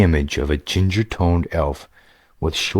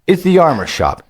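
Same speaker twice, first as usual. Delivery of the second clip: put-on lightly raised, eager young gamer voice; a casual young player, a bit higher than the narration; upbeat young adventurer, higher pitched; young hero Greylick; 12 semitones higher, 4 dB louder than usual.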